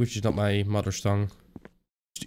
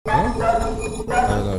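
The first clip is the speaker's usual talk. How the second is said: high, drawn-out squeak; squeaky I don't know; 12 semitones higher, much slower than usual. Real, not acted. Puzzled gamer squeaking at a guess, high and slow. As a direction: let it squeak up in confusion.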